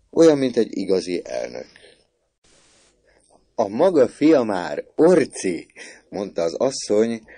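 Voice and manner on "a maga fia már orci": high, loud, slow announcement; amused exclaiming. A narrator's reading. Mother telling father about their son, amused exclaiming. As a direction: announce it as a great joke. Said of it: "remegett a kacagástól".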